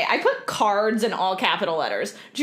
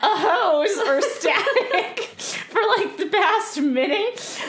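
giggling through the apology; can't stop laughing while talking; 8 semitones higher than usual. Real, not acted.

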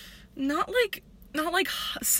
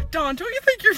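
high, loud exasperated quote; quoting a musical character; 9 semitones higher, 4 dB louder than usual. Retold or imitated. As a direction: shout it like the waitress scolding Dawn. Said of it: "And she goes".

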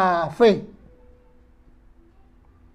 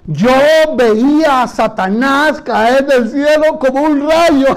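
slow loud declaration; 3 semitones higher, 4 dB louder, slower than usual. Shown as a demonstration. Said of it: "Díganlo en voz alta".

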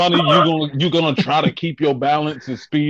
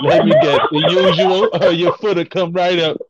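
laughing, louder sassy waitress; 4 semitones higher, 4 dB louder than usual. Pretend. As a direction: play a sassy diner waitress. Playing sassy diner waitress.

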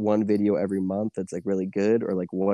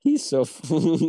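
mock announcer voice; 7 semitones higher, 3 dB louder, slower than usual. Echoing the Nintendo Direct line.

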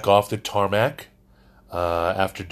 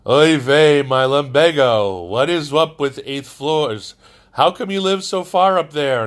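put-on old Catskills comic, complaining, higher; Catskills accent; 5 semitones higher, 5 dB louder than usual. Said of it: "Catskills accent that I gave to the prince".

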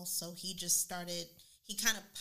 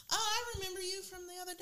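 mock girl; 13 semitones higher, faster than usual. Imitating the girl at the pool.

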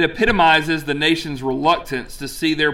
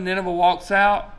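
ordinary talk

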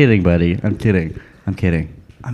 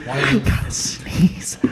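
very slow, drawn-out whisper; mock whisper; 7 dB quieter, much slower than usual. Host repeating Will's whispered sneeze warning for laughs, mock whisper. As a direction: whisper it softly in imitation. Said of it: "Why did you whisper that".

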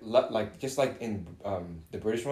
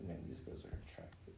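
whispering; 8 semitones lower, 21 dB quieter than usual. Retold or imitated. Quoting his childhood thought.

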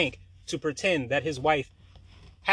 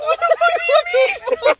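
laughing squeal; 13 dB louder than usual. Retold or imitated. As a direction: stretch it out, laughing in disbelief. Shocked, laughing retelling.

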